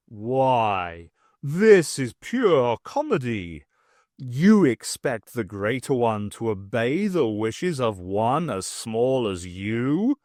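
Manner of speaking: mocking villain. A narrator's reading. The greater one mocks the dwarf, low and slow. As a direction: sneer with amused contempt. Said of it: "Then he started to laugh".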